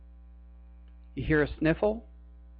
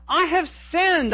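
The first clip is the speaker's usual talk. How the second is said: pleading confession; 10 semitones higher than usual. Retold or imitated. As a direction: cry out the sin as Judas. Judas confessing again, stronger.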